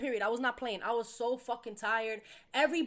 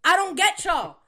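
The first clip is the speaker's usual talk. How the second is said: high, loud exasperation; exasperated; 9 semitones higher, 14 dB louder than usual. Real, not acted. Venting to the co-host about roommates, exasperated. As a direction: throw up your hands. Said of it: "repeated exasperation".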